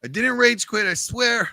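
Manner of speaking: high mock-whiny denial; whiny protest. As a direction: plead your innocence in a high whine. Repeats denial, pitched up.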